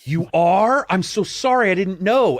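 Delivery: high, loud, earnest dimwit; Joey playing dumb. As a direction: play a sweet idiot who totally misunderstood. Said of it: "Joey's so dumb".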